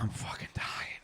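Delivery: hushed, voiceless moan of exhaustion; weak retold plea under the breath; worn-out murmur. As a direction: let it out faintly, as if spent.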